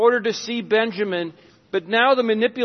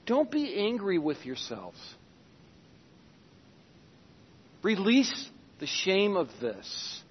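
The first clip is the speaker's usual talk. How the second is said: tender reassurance; 4 semitones lower, 8 dB quieter than usual. Acted. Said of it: "That's the kind of tenderness".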